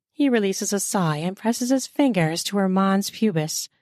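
ordinary delivery

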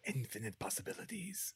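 breathy whispered awe; mock awe whisper. Answering cohost's infinite universes, in a mock awed whisper. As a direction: whisper it like a cosmic revelation.

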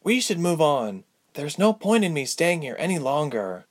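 a hint of a solemn young heroine; Ilse, urging departure. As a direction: urge leaving in a lifted character voice.

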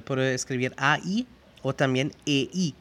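ordinary talk

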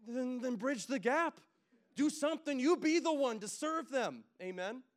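high, loud, slow pulpit exhortation; fired-up preacher pushing the church to act; preaching at full force. Acted. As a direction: drive it home to the congregation, slow and loud.

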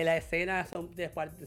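normal talking voice

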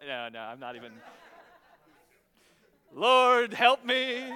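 loud laughing cry to the Lord; half-laughing prayer; laughing plea. Real, not acted.

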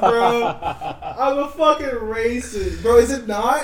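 high, loud self-mocking outburst; shocked disbelief. Real, not acted. Realizing own mistake about Jamaica, high shocked outburst. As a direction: cry out in real disbelief.

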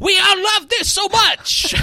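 loud high hammed-up cheer; mock cheer shout.

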